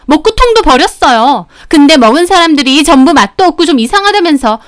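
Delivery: high, loud angry customer; indignant yelling. Acted. Humiliated customer berating the shop owner, shouting. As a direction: rant at full pitch with swooping stresses. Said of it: "막 소리를 지르는".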